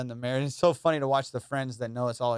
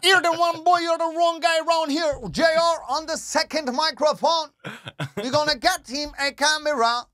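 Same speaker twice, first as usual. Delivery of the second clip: much higher, louder Jamaican voice through laughter; Jamaican accent; 13 semitones higher, 5 dB louder than usual. Pretend.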